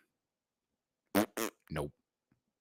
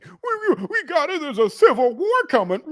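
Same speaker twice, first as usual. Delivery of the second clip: high, laughing dopey voice; mock a dumb guy, way up high; mocking dum-dum; 5 dB louder than usual. Mimicking yesterday's dum-dum to chat, high dopey voice. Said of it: "that dum-dum yesterday".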